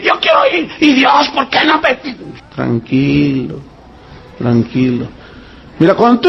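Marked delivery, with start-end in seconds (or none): 0.0-2.0 much higher, shouted mock complaint to God; whiny complaint. Imitating complaining believer. 2.5-5.1 much lower, slow and soothing; calm soothing. Acted. Calming the complainer.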